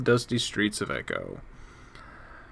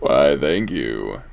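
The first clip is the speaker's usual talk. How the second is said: slow, very low voice; deep microphone voice; 10 semitones lower, much slower than usual. Shown as a demonstration. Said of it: "do good things for my voice".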